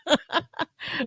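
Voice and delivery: short high giggle to herself. Real, not acted. Host giggling to herself on air, high giggle. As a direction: giggle softly to yourself.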